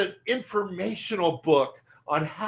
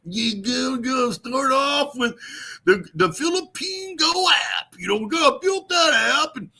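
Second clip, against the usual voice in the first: mock hype voice; 7 semitones higher than usual. Mocking Brett's video, hyping the Philippine Go app. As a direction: ham up Brett's excited pitch. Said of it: "He's all".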